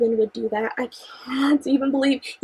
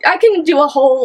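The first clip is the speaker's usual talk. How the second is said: ordinary talk, nothing marked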